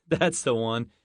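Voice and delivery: laughing. Acted. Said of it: "The American laughed".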